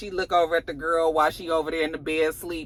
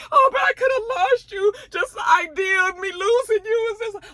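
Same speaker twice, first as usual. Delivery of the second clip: mock crying. Maria to Tommy, hammed-up crying. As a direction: cry out, overacted.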